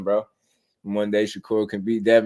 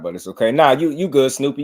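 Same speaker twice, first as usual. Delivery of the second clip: normal talking voice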